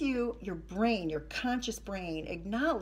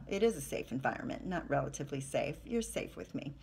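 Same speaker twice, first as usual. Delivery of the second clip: usual speaking voice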